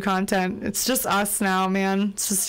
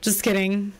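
ordinary delivery

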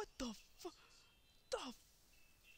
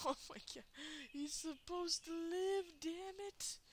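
usual speaking voice

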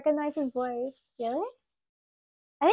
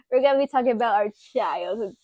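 teasing joke voice; 4 semitones lower than usual. Pretend. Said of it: "No, I'm kidding".

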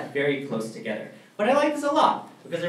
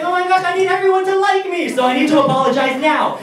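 much higher and louder, panicky; shrill-pitched desperation, much louder; frantic; 11 dB louder than usual. Retold or imitated. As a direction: blurt it like you need everyone to love you. Panicked need to be liked.

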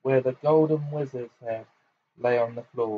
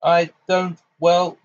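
blustering; 5 semitones higher, 6 dB louder, slower than usual. Acted. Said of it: "blustered Fudge".

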